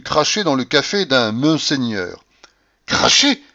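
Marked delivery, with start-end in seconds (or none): 2.9-3.4 high, loud exclamation of shock; pompous astonishment. A narrator's reading. General echoes the narrator's word, pompous astonishment. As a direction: blurt it out, self-importantly astonished. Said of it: "s'exclama le général avec un étonnement plein d'importance".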